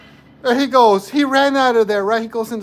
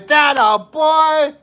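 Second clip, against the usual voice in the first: slow, higher, louder drawn cheer; 8 semitones higher, 5 dB louder, much slower than usual. Retold or imitated. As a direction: stretch the cheer out long and high. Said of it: "and I'm like".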